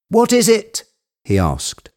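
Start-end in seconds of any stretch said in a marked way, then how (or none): 0.1-0.8 high, eager question; very excited. A narrator's reading. Legrand asking what Jupiter has found, very excited. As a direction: demand the news at a high pitch. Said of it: "became even more excited".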